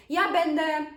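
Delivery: "ja będę" with a light final ę and no M sound at the end; natural pronunciation. Shown as a demonstration. Teacher to learners, showing how natives really say it. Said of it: "You hear how I say it?".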